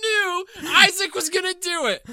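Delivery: high, loud burst of triumph; excited shout. Real, not acted. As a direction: yell it out, caught him. Shouting at the swear.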